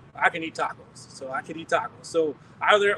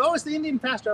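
playful hint of an Indian accent, pitched up; 9 semitones higher, 3 dB louder than usual. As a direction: greet him teasingly in a light Indian accent. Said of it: "all the voices I make are Indian".

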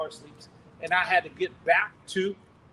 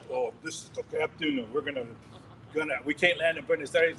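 shaky captain; 4 semitones lower than usual. Retold or imitated. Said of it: "his voice is all shaky".

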